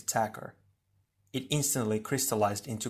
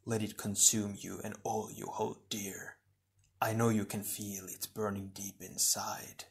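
soft, breath-heavy sinister whisper; quiet, insidious coaxing; whispered temptation; 2 semitones lower, 7 dB quieter than usual. A narrator's reading. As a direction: tempt them in a hushed voice. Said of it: "it whispered".

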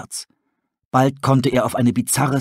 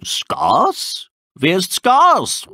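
high, slow, puzzled owl voice; owl asking; 8 semitones higher, much slower than usual. Acted. Tully asking the wolves who Skars is, puzzled. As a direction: draw out the question, stress on Skars.

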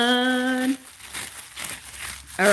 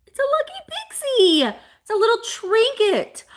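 much higher, cutesy show-and-tell voice; 12 semitones higher, 3 dB louder than usual. Acted. Seller showing viewers a Lucky Pixie, in a high cutesy voice. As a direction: coo over the little trinket.

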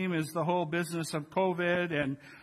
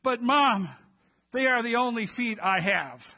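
put-on indignant child; 4 semitones higher, 5 dB louder than usual. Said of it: "replies indignantly".

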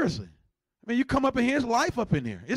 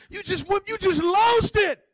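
high-pitched exclamation, stretched out at the end; comic outburst; 10 semitones higher, 4 dB louder, slower than usual. Acted. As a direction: blurt it high, then drag out the punch. Comic outburst after film impression.

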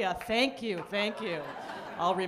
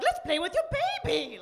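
giddy high-pitched high; 8 semitones higher than usual. Pretend. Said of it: "be like".

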